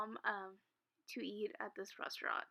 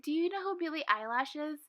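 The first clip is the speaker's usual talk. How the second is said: nosy lady voice; 5 semitones higher, 5 dB louder than usual. Retold or imitated. Lady asks the waiter.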